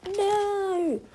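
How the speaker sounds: long, high-pitched "no"; high storybook chorus of protest; protesting cry. A narrator's reading. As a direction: cry out a drawn-out objection. Others reject Homie's silly name.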